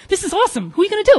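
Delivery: high, loud, giddy outburst; excited squeal. Retold or imitated. Asking her co-conspirator about the plan, excited. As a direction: gush with delight in a high voice.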